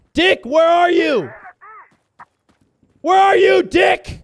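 loud long call across the map; shouting for Dick. Real, not acted. Player calling across the map for teammate Dick, loud shout. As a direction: yell out to find a lost teammate.

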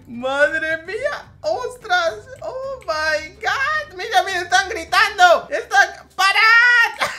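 high, loud, laughing shouts; laughing excited yells. Real, not acted. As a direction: cry out between laughs as chaos breaks out. Players' cars pile up, laughing.

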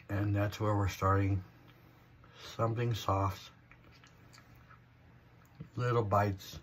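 talking while chewing; biting food mid-sentence; mouth full of cheese. Real, not acted. Seen 2: bites yellow cheese stick, food visible in mouth while talking.

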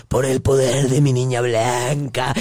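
slow ritual chant voice; incantation. Retold or imitated. Cursing the caller by the Santa Muerte, a slow incantation. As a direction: intone it like a curse. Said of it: "Y yo le decía".

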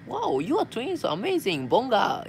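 high-pitched excited wow, re-enacted; amazed reaction. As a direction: act out delighted amazement, pitch swooping up. Re-enacts reaction to seeing twins.

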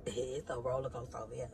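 low, soft chuckling through the words; laughing. Real, not acted. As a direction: laugh quietly at your own chaos. Laughs welcoming new viewers to channel.

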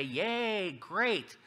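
high, drawn-out cheer; playful "yay" of approval; mock cheer. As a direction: cheer like a delighted supporter, put-on. Acting out pleased Herodians.

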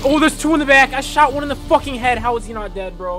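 high, loud frustrated yell; frustrated shouting. Real, not acted. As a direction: yell in disbelief.

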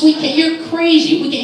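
high, loud, incredulous refusal; acted protest. Dad refusing to play werewolves with his son, loud protest. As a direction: pitch way up and protest loudly.

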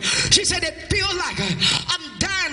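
driving testimony that climbs to a high shout; high-pitched, shouted preacher climax; full-force preaching. Real, not acted.

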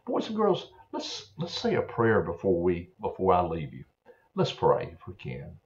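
normal talking voice

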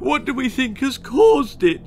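high anxious whimper. Acted. Mother Sissy worrying about her daughter, high whimper. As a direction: fret in a thin high voice. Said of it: "she whimpered".

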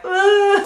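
loud, high mock groan; emotional groan. Vlogger showing how baby gifts get her, emotional groan.

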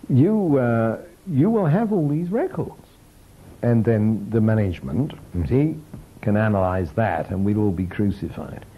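put-on Yorkshire accent. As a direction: slip into a mild Yorkshire accent as the union man. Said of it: "I'm a Yorkshire accent reverberating".

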